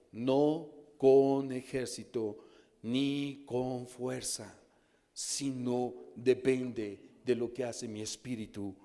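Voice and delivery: very slow, word by word; emphatic scripture reading. Acted. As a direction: lay each phrase down slowly and heavily. Lee el versículo clave. (lectura lenta y enfática del versículo)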